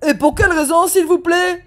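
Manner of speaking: a father's sharp polite question; stiff demand. Acted. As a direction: demand an explanation with icy courtesy. Dad demanding again.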